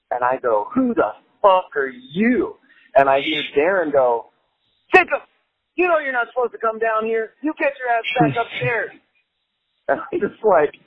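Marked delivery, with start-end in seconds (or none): 0.7-2.6 slow, higher edgy mock retort. Edgy young guest answering the toothless stranger, slow mock challenge. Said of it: "little more edgy in my youth". 4.9-8.9 high-pitched mock scolding; scolding host. Host sending Jacob back upstairs, high mock telling-off.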